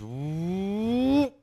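long rising whoop; imitated car whoosh. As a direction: draw out a whoop that climbs in pitch. Imitates a car speeding past.

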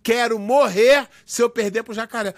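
enfático, pausado, como declaração solene; manchete levada a sério. Retold or imitated. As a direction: leia como manchete dramática de revista. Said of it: "Os caras publicaram isso na revista". (declaração pesada, palavra por palavra)

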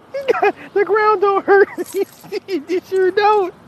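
high, slow words through real laughter; squealing with laughter, words stretched out; laughing. Real, not acted. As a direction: try to quote it while cracking up. Still cracking up at the quote.

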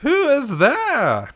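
high, slow crone call; old woman challenging. A narrator's reading. Old woman at the door, suspicious. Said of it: "she exclaimed, coughing loudly".

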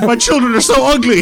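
horrified dad. Pretend. Voicing dad in glasses ad.

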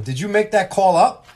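a loud, high accusation; mock angry coach. Coach accusing the ref of making up a call, loud.

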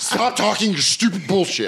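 high-pitched comic outrage; mock exasperated scolding. Waiter scolding diners who got comedy wrong, mock outrage. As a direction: tell them off in hammed-up frustration. Acted scolding of ignorant customers.

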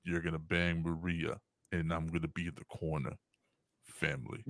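low, quiet gruff tough-guy voice; lightly voiced action hero; Vin Diesel impression. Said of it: "How do you keep your voice so low?".

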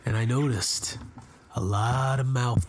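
low, mock-serious storyteller narration; storyteller impression. Host narrates the fan fest visit to co-hosts as a hammed-up storyteller. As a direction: tell it like a gritty narrator. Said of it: "You're doing your storyteller, like, impression".

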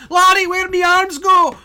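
very high, loud hammed-up cry; mock panicked wail. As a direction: shriek it in comic despair. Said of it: "imagining myself running around and being like".

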